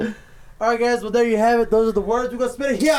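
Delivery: show host voice. Acted. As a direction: present the wheel like a game show host. Host announces the wheel spin.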